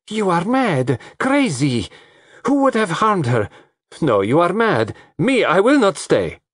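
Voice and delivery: shrill outburst of a frightened woman; sharp rising voice. Acted. Lola flaring at Russell's accusation, sharp and high. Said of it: "Lola's voice rose sharply".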